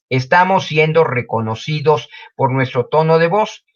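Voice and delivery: ordinary delivery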